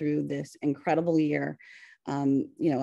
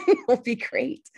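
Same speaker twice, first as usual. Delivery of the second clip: laughing through the words, pitch jumping high; giggling embarrassed hope; 12 semitones higher, 4 dB louder, slower than usual. Real, not acted. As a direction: laugh it out at your own tech mess. Laughing at own screen-share trouble.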